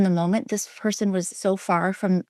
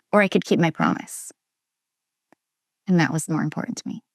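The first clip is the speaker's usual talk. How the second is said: normal talking voice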